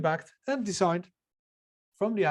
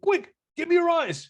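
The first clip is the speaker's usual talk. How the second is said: high squeaky cartoon bunny, much louder; 13 semitones higher, 11 dB louder than usual. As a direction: squeal it out like a silly cartoon rabbit. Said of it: "one bunny says to the other".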